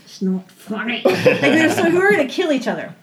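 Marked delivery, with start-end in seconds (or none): 0.1-1.0 quiet, strained mock warning; imitating tightly contained irritation; retold tense warning. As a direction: say it softly, at the end of your patience. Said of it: "he grabbed my hand, like, really tight".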